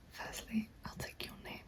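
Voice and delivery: usual speaking voice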